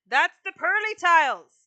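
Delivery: insist, as if they aren't listening; emphatic loud insistence. Real, not acted. Streamer insisting to her chat, loud and emphatic.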